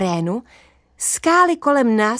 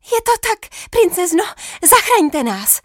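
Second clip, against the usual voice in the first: much higher, begging voice; pleading knight; 9 semitones higher than usual. Acted. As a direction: beg her to save them all.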